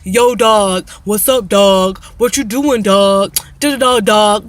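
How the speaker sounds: loud mock street slang; loud hammed-up yo dog chatter; mocking yo dog talk. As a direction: parody the guy on the phone. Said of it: "This man so many times on that phone was like".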